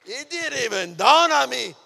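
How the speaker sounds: high, full-force preacher's shout; exclaiming in amazement. Real, not acted. Preacher testifying he was actually reading, amazed shout. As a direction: cry it out high and very loud.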